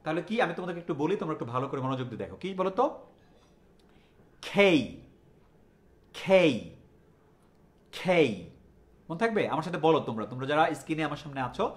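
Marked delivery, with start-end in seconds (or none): 4.5-8.3 forceful kh in each খেই; loud aspirated K. Shown as a demonstration. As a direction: drill খেই loudly with a puff on kh. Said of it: "আমি যেভাবে বলছি জোরে জোরে".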